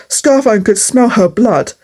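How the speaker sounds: ordinary delivery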